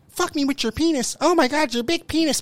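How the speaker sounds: high, breathy-free hammed-up moan voice; mock sultry woman. Mocking a woman in bed, high and hammed-up. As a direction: ham up a woman moaning. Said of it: "If a bitch get in the room and says".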